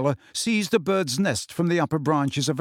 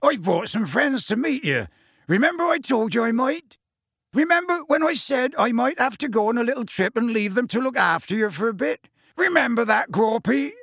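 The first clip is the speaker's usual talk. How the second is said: high, raised character voice; high-pitched shouted coaxing, as to someone who barely understands; Hagrid shouting; 9 semitones higher than usual. Acted. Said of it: "shouted Hagrid".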